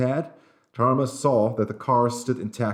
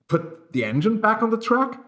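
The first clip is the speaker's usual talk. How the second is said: panicky; 12 semitones higher than usual. A narrator's reading. Panicked passenger after derailment.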